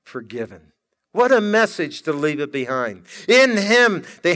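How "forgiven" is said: much softer, an almost breathed Forgiven; a quiet, reverent echo of the word. Acted. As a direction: repeat it to yourself under the breath. Echoing the word after reflection.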